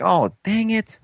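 mock disappointed. Voicing villain's disappointment.

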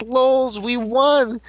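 high-pitched victory crow; hammed-up villain celebration; mock gloating. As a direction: crow over the win, playfully. Villain celebrates winning, acted.

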